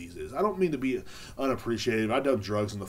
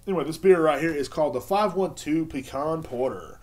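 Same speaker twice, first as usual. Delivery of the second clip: ordinary delivery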